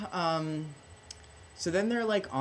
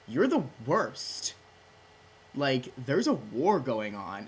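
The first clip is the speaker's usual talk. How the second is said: scolding father; 3 semitones higher, 5 dB louder, slower than usual. Retold or imitated. King scolding drunk son.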